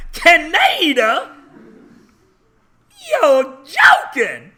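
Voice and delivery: very high, loud, slow disbelief; laughing mock astonishment; amazed Southerner. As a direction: gasp out Canada in shock.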